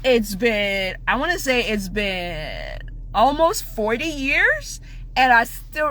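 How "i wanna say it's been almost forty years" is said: ordinary delivery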